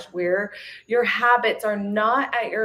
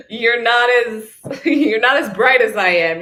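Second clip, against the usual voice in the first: laughing through a louder teasing jab; laughing tease; 3 semitones higher, 6 dB louder than usual. Acted. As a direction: giggle while poking fun at your gloomy self. Teasing her dark side.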